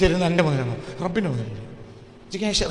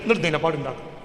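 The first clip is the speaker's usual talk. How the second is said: usual speaking voice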